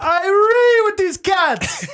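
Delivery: put-on very high, slow Trinidad accent; mock Trinidad singsong. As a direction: stretch it out in a hammed-up Trinidad lilt. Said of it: "always wanted to sing in Trinidad".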